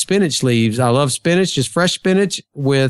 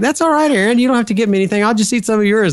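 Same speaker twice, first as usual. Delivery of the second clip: higher-pitched mock wife, sweetly declining; imitating his wife; 8 semitones higher, faster than usual. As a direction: play the wife brushing off the offer, voice pitched higher. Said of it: "And she's like".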